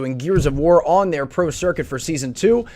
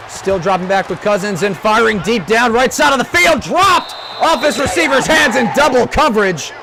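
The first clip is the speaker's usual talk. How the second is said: excited shouting call; 7 semitones higher, 5 dB louder than usual. Acted. Deep pass call.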